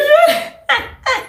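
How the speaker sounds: high burst of laughter; amused laugh. Real, not acted. Laughing at the Holy Spirit story, high giggling laugh. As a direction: crack up in a high cackle.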